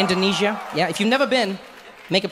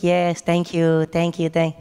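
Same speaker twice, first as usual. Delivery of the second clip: strained polite host; 3 semitones lower, much slower than usual. Retold or imitated. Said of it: "He's like".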